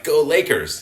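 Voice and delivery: playful mock rooting; mock fan cheer. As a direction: toss off a bandwagon cheer. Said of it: "they're like".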